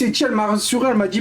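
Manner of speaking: ordinary delivery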